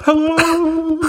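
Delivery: high, warbling underwater voice. Shown as a demonstration. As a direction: call out as if from underwater. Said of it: "do the underwater voice".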